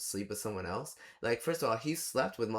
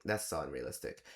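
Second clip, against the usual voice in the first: dry deadpan retort; 7 semitones lower, 5 dB quieter than usual. Retold or imitated. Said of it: "I'm like".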